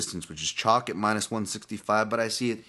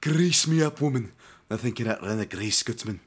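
Willie from The Simpsons; 4 semitones higher than usual. Acted. Fan imitating Willie for podcast listeners, exaggerated Scottish accent. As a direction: belt out a cartoon Scotsman's boast. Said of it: "Willie from The Simpsons".

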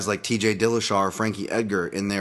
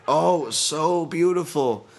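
delighted gush; 6 semitones higher than usual. Real, not acted. Admiring a liver kick.

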